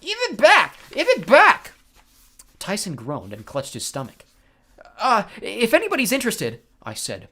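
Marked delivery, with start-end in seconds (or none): 0.0-1.7 very high, loud yell; furious yelling. A narrator's reading. 5.0-6.6 raised, louder nervous voice; alarmed interjection. A narrator's reading. Frightened passenger interrupts the fight.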